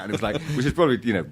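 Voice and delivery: ordinary talk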